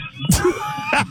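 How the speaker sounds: sudden high burst of giggling; high giggle. Real, not acted. Caller giggling before doing the accent, high giggle. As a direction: giggle shyly before the demo.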